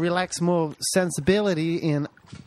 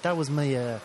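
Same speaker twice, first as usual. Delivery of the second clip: ordinary talk, nothing marked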